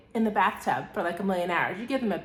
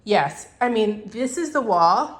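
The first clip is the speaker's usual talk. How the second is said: normal talking voice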